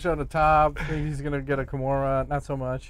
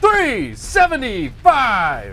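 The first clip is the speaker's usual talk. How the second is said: hyped announcer shout; 10 semitones higher, 6 dB louder, much slower than usual. Acted. Co-host echoes episode number.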